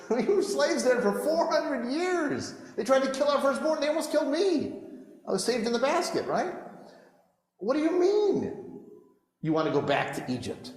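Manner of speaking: Moses, high-pitched and exasperated, arguing with the people. Acted. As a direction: argue back, amazed they'd want to go back.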